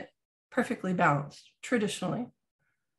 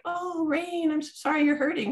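high, syrupy pretend pity; mock sympathy; 8 semitones higher than usual. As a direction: imitate a coddling voice, pitched high. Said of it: "There was no like".

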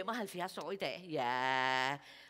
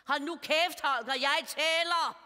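raised, louder voice, slowing on the punchline; mock-annoyed wife silencing her husband; irritated retort; 5 semitones higher, 4 dB louder than usual. Acted snappy retort to husband.

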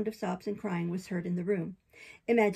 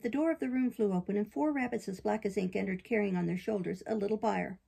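plain reading voice

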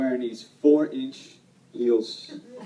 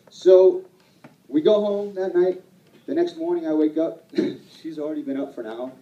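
ordinary talk

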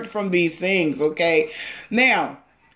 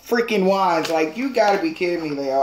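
ordinary talk, nothing marked

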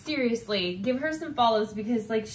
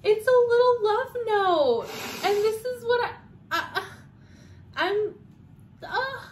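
higher, tearful, choking up; real emotional tears over a gift; on the verge of tears; 9 semitones higher, 4 dB louder than usual. Real, not acted. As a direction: fight back happy tears. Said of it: "I literally am gonna cry".

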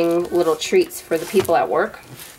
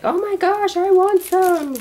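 put-on much higher, squeaky kid voice begging for treats; imitating excited little daughter; 13 semitones higher than usual. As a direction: play your eager daughter running in, high-pitched and pleading. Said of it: "she's gonna come running and be like".

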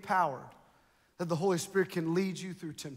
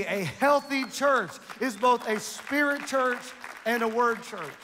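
higher, louder sermon climax, slowing on the big phrases; impassioned preaching; 4 semitones higher, 5 dB louder than usual. Real, not acted. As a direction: drive the point home to the congregation. Preaches at full force.

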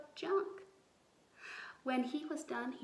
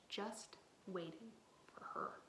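fast hushed storyteller whisper; storyteller whispering about the devil; spooky whisper; 2 semitones lower, 10 dB quieter than usual. A narrator's reading. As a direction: whisper it like a scary secret.

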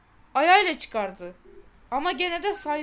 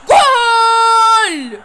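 loud, high goal yell; goal shout; 7 semitones higher, 13 dB louder than usual. Real, not acted. Kid scoring in a football game, yelling goal. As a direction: roar the goal in triumph.

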